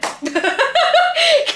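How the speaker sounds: sudden high cackle of laughter; high burst of laughter. Real, not acted. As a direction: laugh out loud at her own story. Laughing at own story.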